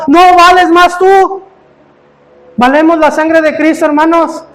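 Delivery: high, loud, building preacher's climax; preaching at full force. Acted.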